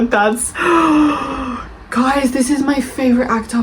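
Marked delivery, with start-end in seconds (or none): 0.6-1.9 sharp gasp of disbelief; shocked gasp. Real, not acted. Vlogger gasps at a review insulting her favorite book, shocked gasp. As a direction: gasp in outrage.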